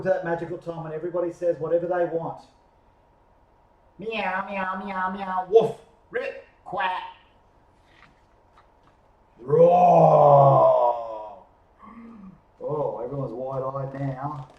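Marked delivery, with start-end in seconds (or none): 4.0-7.0 string of meows, then woof, ribbit, quack; animal noise medley. Acted. All animals sound off at once. 9.4-11.4 long, loud, lower roar; lion roar. Acted. A lion roars.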